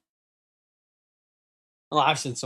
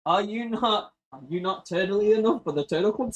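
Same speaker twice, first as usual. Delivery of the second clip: higher playful quote; a touch of goofy movie voice; mock movie line; 5 semitones higher than usual. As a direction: deliver it like a silly film hero.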